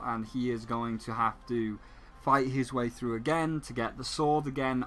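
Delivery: ordinary delivery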